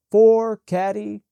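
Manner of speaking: loud, high, slow call across the course; golfer's call. A narrator's reading. Golfer calling his shot across the course, loud and drawn out. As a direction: belt it out slowly to the field.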